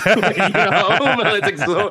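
high, loud laugh-through words. Real, not acted. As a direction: talk through a burst of laughter. Laughs after joking about the accent.

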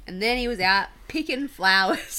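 put-on loud, broad Aussie accent. Contrasting Aussies with the newsreader, broad Aussie accent. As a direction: send up your own Australian vowels. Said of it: "And then you have us going".

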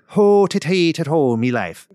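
hammed-up folksy Irish sing-song; mock Irish ditty; jaunty Irish. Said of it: "trying to bring in the Irish people".

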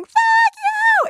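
very high, drawn-out mock scream; squealed mock scream, sky-high but not full volume; imitated scream. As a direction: act out a scream that finally gets out. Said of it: "I can't get my scream out".

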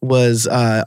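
normal talking voice

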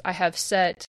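words pushed out on a burp. Real, not acted. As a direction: talk through a burp. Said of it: "let me just burp right in the".

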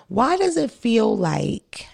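slow unvoiced whisper; slow, hushed wondering; whispered question. Real, not acted. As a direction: breathe the question out slowly.

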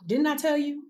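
high-pitched told-you-so exclamation; exclaiming. A narrator's reading. King complaining to Jehoshaphat about the prophet, exclaiming. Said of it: "the king of Israel exclaimed".

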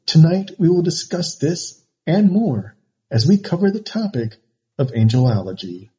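dramatic narration. Acted. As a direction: build mystery like a trailer. Dramatic topic intro.

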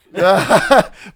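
a real, loud laugh. Real, not acted. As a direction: laugh out hard. Laughs at spoiler joke.

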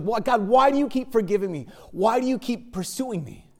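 high, imploring questions to God; imitated heartfelt begging for an answer; torn-apart pleading. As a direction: retell an anguished prayer, voice lifted. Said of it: "Her heart was just really torn apart".

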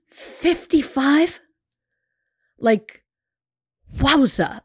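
high, slow disbelieving question; incredulous. Real, not acted. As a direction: stretch it out in disbelief. Shocked by fifty-five wives.

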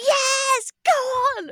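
high, loud retold cheer; a replayed yell of joy at the telly; retold celebration. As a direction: relive jumping off the sofa at the save. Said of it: "I was like".

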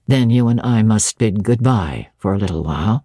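low-pitched voice of the master; Rochester. Acted.

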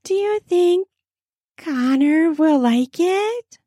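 high, sweet questioning voice; sugary high-pitched girl, stretching the end; sweet girl's voice. A narrator's reading. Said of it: "We hear a sweet voice".

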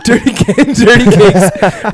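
laughing through the name, slowing right down; can't get the words out for laughing. Real, not acted.

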